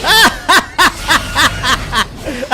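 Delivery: very high yelp then laugh; startled then cracking up; laughing shout; shriek into laughter. Real, not acted.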